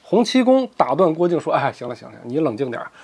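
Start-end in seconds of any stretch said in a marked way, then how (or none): 1.5-2.9 low, soft hushing; calming down. Acted. Hong Qigong hushing Guo Jing mid-song, low and soft. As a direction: ease the boy down, quietly. Old man calms the boy.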